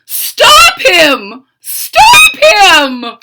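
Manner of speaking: high, loud frantic order; booming panicked mad doctor; frantic shout. Acted. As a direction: yell at the minions.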